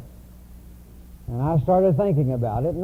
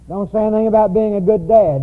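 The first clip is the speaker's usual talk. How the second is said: higher, louder stunned echo of her words; shocked disbelief; 5 semitones higher, 5 dB louder, faster than usual. Retold or imitated. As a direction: repeat it as if you can't believe it. Retelling his own shocked thought.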